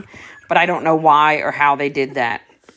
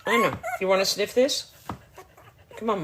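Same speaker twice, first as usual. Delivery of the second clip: normal talking voice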